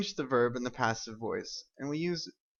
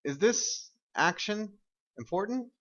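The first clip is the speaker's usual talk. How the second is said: questioning; 8 semitones higher than usual. Shown as a demonstration. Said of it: "I'm asking".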